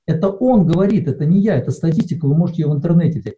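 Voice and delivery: ordinary talk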